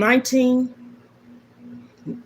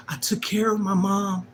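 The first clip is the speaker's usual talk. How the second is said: whispered, holding back tears; 2 semitones lower, 6 dB quieter than usual. Real, not acted. Grieving daughter recalls caring for mom, whispered.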